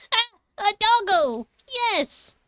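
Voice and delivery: high, loud, delighted cry at a dog; excited delight. Real, not acted. Player spots a dog in the game, excited.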